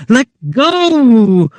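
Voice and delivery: high, loud cry; wife crying out in alarm. A narrator's reading. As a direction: yell at him to let go. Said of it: "she cried".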